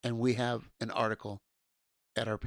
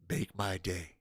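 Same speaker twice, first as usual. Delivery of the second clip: "Make my day" dropped much softer and low; softer repeat; 5 semitones lower, 5 dB quieter, slower than usual. Pretend. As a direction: murmur "Make my day" as a cool, quiet threat. Repeating the movie line.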